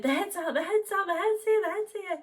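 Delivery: high, loud, urgent midwife calling it out; excited midwife. Retold or imitated. Midwife telling the mother the head is out, excited and loud. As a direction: announce the head breaking through, breathless with excitement. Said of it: "she was like".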